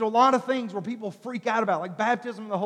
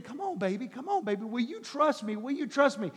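soft, tender plea; tender coaxing; 7 dB quieter than usual. Retold or imitated. God tenderly pleading with his child, soft. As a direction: coax like to a baby.

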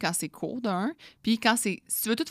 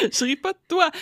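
laughing; 10 semitones higher, 7 dB louder than usual. Real, not acted. Still laughing, reassuring.

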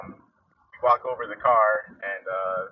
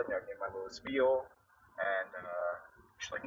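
ordinary talk, nothing marked

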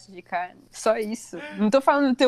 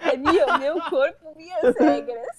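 laughing; 5 semitones higher than usual. Real, not acted. Defending his absurd argument laughing.

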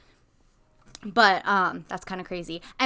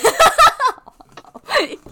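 loud laughing fit; 11 dB louder than usual. Real, not acted. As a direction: burst of high laughter.

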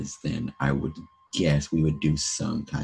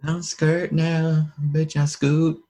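cocky; 11 semitones higher, much slower than usual. Pretend. Cocky joke voice.

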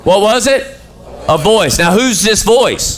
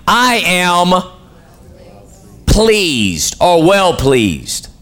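slow drawn out; much slower than usual. Acted. Preacher voicing the Father.

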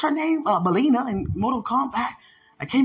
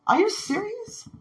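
disbelieving; 8 semitones higher than usual. Retold or imitated. Speaker amazed that they planted a tree, disbelieving. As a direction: ask it in high-pitched amazement. Stunned at being honoured with tree.

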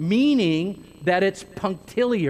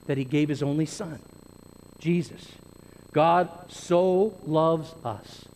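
normal talking voice

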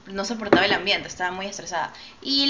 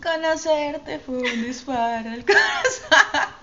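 mock melodrama; 6 semitones higher, much slower than usual. Mock dramatic lament, laughing.

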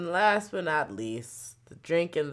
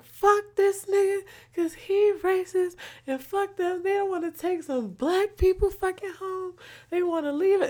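yelling outside. Retold or imitated. Friends yelling at the driver outside, high and angry. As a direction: belt it out furious. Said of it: "we was outside yelling".

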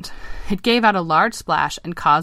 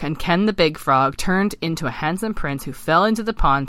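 plain reading voice

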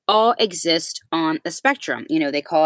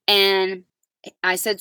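usual speaking voice

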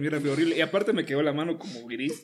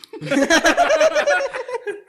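loud high-pitched burst of laughter; 8 dB louder than usual. Real, not acted. Slip about getting burned. (carcajada fuerte y aguda)

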